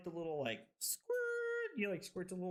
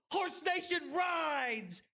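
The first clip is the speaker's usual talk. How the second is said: slow, drawn-out war cry, much higher; triumphant shout; 11 semitones higher, much slower than usual. Real, not acted. Streamer hyping his chat, slow triumphant war cry. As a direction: bellow it like a battle chant. Celebrating back-to-back wins.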